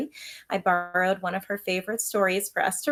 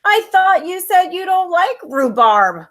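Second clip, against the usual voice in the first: high, louder, lips pursed; through pursed lips; 10 semitones higher, 12 dB louder, slower than usual. Acted. Sister talking through lips puckered from rhubarb. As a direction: talk with a sour mouth. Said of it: "I said through pursed lips".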